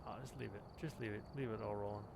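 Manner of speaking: ordinary talk, nothing marked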